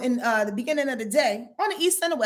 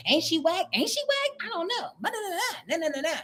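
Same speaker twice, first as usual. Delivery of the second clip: high, hushed catty gossip; a catty clique sniping behind her back, higher and softer; 7 semitones higher, 4 dB quieter than usual. Acted. Gossiping friends about Cynthia, high and hushed.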